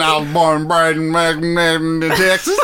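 imitation Texas speech; mock Texan accent. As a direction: play a proud Texan, accent laid on thick. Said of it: "went to Texas and you were like".

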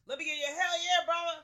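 loud high hype call; rally the fans with a yell; hype shout. Real, not acted.

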